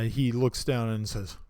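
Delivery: normal talking voice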